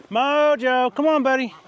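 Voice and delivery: high, loud call to the dog. Real, not acted. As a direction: call him from across the yard. Calling the dog.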